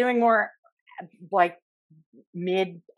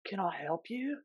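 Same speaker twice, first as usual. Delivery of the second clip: soft, spaced-out surfer voice; stoned station attendant; 13 dB quieter than usual. Retold or imitated. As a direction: imitate a dazed Keanu-like dude. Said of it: "pure Bill and Ted Keanu".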